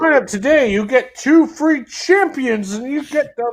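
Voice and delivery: high, loud hard-sell announcer; mock ad pitch. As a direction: sell it like an over-the-top game promo. Parody of a game ad.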